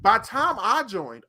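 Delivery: normal talking voice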